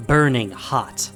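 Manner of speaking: ordinary delivery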